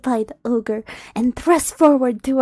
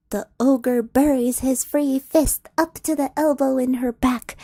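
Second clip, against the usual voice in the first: ordinary talk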